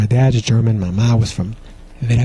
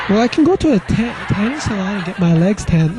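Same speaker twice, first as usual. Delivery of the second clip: put-on pleading boyfriend; 8 semitones higher than usual. He pleads with girlfriend.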